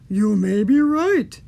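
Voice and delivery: loud, slow monster voice; Gruffalo voice. A narrator's reading. Gruffalo concedes to mouse, loud slow monster voice. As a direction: concede it as a big booming beast. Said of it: "said the Gruffalo".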